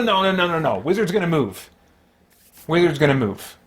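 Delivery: normal talking voice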